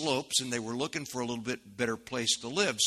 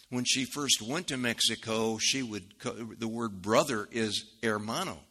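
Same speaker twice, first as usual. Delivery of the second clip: usual speaking voice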